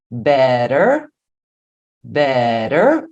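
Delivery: "Better" drawn out with a tapped R for the T; natural flap T. Shown as a demonstration. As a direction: say "Better" as "be-rer". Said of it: "Este som você já faz naturalmente". ("Better" com o T virando R)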